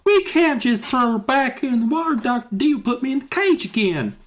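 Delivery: very high, put-on cartoonish voice; excitable high character voice, loud at the peaks; Alex. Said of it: "What's the voice we gave him?".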